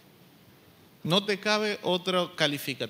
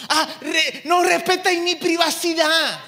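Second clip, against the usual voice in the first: put-on much higher, louder whiny teen voice; mocking sulky teenager; 9 semitones higher, 8 dB louder than usual. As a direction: parody the fed-up teenager talking back. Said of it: "¿Qué es lo que va a decir el joven?".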